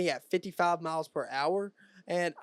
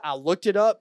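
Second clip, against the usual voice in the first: ordinary delivery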